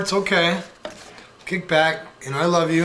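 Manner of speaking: ordinary delivery, nothing marked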